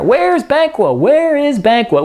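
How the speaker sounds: high, swooping show of searching; feigned wondering. Acted. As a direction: ask for everybody to hear, pretending ignorance. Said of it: "but he's saying for everybody".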